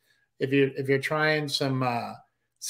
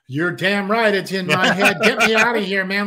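loud, high, desperate and half laughing; a dad answering his daughter while freaking out, frantic shout; frantic yell; 5 semitones higher, 7 dB louder than usual. Retold or imitated. Retelling a panicked reply to his daughter.

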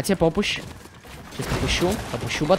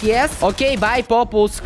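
usual speaking voice

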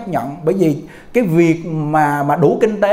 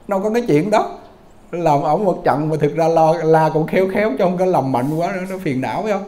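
ordinary talk, nothing marked

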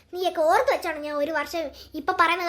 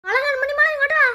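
mother calling daughter; 7 semitones higher, 4 dB louder than usual. Acted. Mother calls child.

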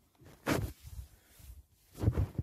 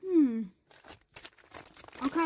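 ordinary delivery

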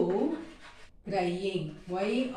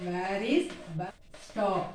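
very slow, each word spaced out for learners; slow model sentence; 4 dB quieter, much slower than usual. Shown as a demonstration. Teacher to Tamil students, modelling the question very slowly. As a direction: spell it out slowly for the class. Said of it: "teacher models full sentence".

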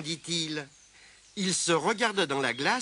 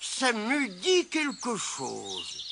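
very slow, higher-pitched musing; pondering recall; 5 semitones higher, much slower than usual. A narrator's reading. Mole half remembers Mémé Croche's surprise, pondering. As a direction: stretch each word while searching memory. Mole searches his memory.

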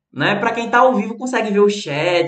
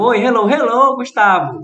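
higher and louder welcome to a viewer; cheerful greeting; 5 semitones higher, 5 dB louder than usual. Real, not acted. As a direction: greet a newcomer brightly.

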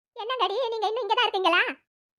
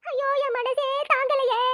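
slow, higher-pitched cry of dismay; distressed lament; 4 semitones higher, slower than usual. Acted. Woman hears the girl has not eaten, slow distressed lament. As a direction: draw it out as a pained lament.

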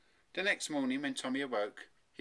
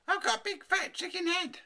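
put-on high, loud boyish wail; horrified cry; 6 dB louder than usual. A narrator's reading. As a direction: wail in high-pitched dismay. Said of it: "he cried".

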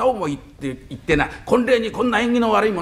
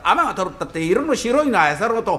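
plain reading voice

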